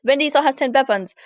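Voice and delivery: brisk put-on German and Russian accent; mixed German-Russian-English accent. Shown as a demonstration. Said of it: "You say it in kind of a mixed German, Russian, English accent".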